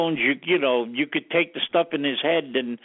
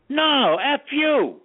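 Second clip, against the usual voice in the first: defiant comeback; 11 semitones higher, 3 dB louder, much slower than usual. Retold or imitated. Firing back an insult at Yoko.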